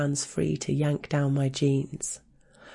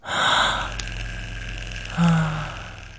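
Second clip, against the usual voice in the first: long breathy sigh; 3 semitones higher than usual. Acted. Sigh after the plea.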